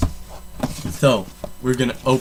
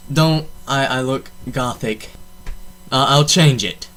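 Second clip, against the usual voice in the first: ordinary delivery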